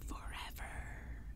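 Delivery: faint, slow, far-off hissing verdict; ghostly declaration. Acted. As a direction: draw it out like a doom. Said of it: "they declared".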